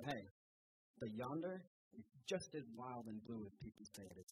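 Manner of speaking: ordinary talk, nothing marked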